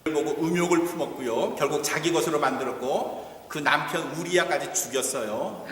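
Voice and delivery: ordinary talk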